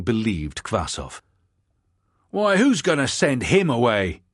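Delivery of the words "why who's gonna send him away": loud, high convict shout. Acted. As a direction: call it out across the room. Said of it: "shouted one".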